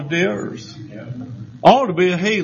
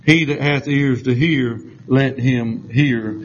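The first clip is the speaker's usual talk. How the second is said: scripture reading; 4 semitones lower than usual. Acted. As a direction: read the verse with solemn weight.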